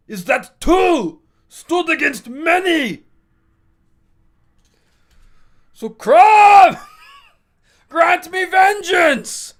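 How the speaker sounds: over-the-top mock-epic barbarian roaring at the sky; bad Schwarzenegger impression. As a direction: ham up Arnold's vengeance speech to Crom. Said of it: "my bad Schwarzenegger impression".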